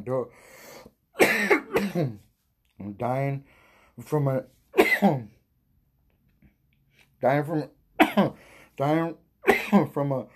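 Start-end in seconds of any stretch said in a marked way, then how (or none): 1.2-10.4 choking out words between coughs; coughing fit. Real, not acted. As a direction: struggle to talk through a real coughing fit. Coughing fit while reading the fact.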